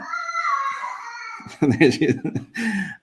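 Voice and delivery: high burst of laughter; laughing. Real, not acted. Laugh after baby leaves.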